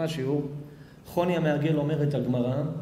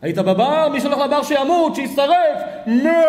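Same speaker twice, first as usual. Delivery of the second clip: loud, higher fanatic yelling; harsh angry rebuke; 8 semitones higher, 6 dB louder than usual. Shown as a demonstration. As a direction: blast him like a zealot. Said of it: "יש דרך לדבר עם בני אדם". (צעקה זועמת וקנאית, רמה וגבוהה)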